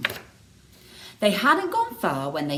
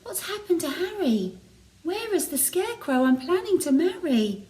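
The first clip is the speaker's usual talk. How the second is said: worried Betty; 10 semitones higher than usual. A narrator's reading. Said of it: "Betty was worried".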